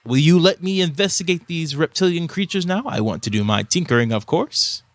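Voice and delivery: inquisitive elvish tinkerer. Acted. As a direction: savor each word like a robed arcanist.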